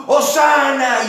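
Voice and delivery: high, loud cry; shouted hosanna. Retold or imitated. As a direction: shout it out to the king. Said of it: "y gritan".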